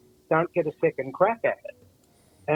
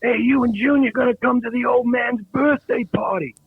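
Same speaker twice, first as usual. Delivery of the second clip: imitated waiter; 9 semitones higher than usual. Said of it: "one of the waiters said".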